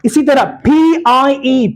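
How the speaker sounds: ordinary talk, nothing marked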